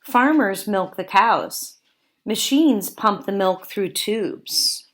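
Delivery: ordinary talk, nothing marked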